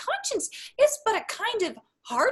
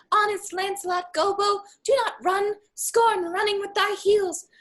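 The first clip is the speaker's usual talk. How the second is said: high-pitched little voice of conscience; put-on high voice giving a warning; conscience's voice; 8 semitones higher than usual. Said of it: "My conscience says".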